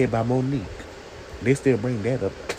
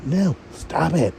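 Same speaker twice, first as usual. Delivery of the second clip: imitating Robin; 7 semitones higher than usual.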